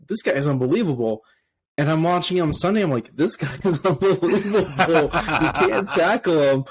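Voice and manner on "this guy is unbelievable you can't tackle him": laughing, higher amazed outburst; excited disbelief. Retold or imitated. Fan recalling watching the rookie on Sunday, excited disbelief. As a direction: relive being amazed, laughing through the words.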